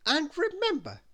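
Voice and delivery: high, loud bossy warning; overbearing character voice; Little Miss Bossy. A narrator's reading. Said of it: "said Little Miss Bossy".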